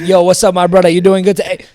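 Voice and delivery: loud, laid-back street greeting; casual bro voice. Retold or imitated. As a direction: greet a friend, loose and loud. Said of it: "you got your regular voice".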